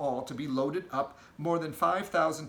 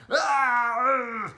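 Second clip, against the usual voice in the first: much higher, very loud pained roar and grunt; giant roars; 10 semitones higher, 8 dB louder than usual. Acted. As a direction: roar as you are winched up. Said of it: "never stopped bellowing".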